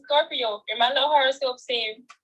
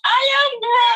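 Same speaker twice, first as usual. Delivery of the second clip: loud, high-pitched indignant shout; indignant protest; 13 semitones higher, 7 dB louder than usual. Real, not acted. Grown woman answering teasing about her little voice, indignant protest. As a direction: cry it out much higher, in protest. Protests being treated as a child.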